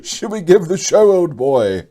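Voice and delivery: high, loud mock British accent; fake British accent. Host gleefully re-quoting the friend, in a fake British accent. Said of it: "says in a fake British accent".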